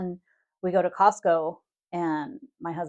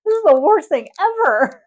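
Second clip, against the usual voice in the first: high, loud mock wail; imitating husband's meltdown; 13 semitones higher, 14 dB louder than usual. Overwhelmed husband begging to leave Costco, mock desperate. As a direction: wail it out, dragging the last words.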